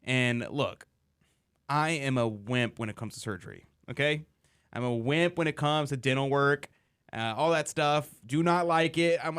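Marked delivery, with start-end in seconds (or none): none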